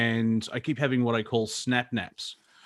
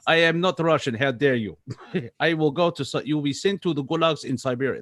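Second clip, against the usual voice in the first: bad Russian accent; 3 semitones higher than usual. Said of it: "That's a very bad accent".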